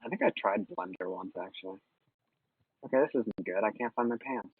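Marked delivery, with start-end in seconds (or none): none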